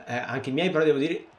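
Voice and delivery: usual speaking voice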